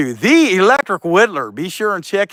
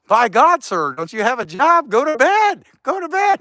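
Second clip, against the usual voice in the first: mock scolding; 6 semitones higher than usual. Mock scolding a Russian viewer.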